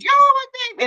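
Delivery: high-pitched gushing wail of a proud mother; hammed-up, squeaky "about to cry" voice; mock tearful coo. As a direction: play at crying over your baby, voice way up. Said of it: "wanna try to be strong".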